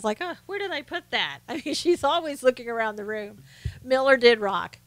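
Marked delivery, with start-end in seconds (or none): 0.2-1.4 higher, softer musing voice; light mock absent-minded searching; Sarah. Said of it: "like, uh, Sarah does".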